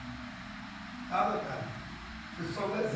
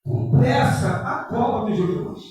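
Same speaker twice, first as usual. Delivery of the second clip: loud, slow, sermon-style scripture reading; slow, loud preacher's Bible reading over music; preaching scripture; 2 semitones higher, 10 dB louder, much slower than usual. Acted. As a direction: proclaim the story to the congregation.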